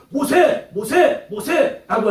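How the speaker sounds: high, loud, sing-song mocking of a name; taunting name call. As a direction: jeer his name over and over. Princes taunting Moses' name.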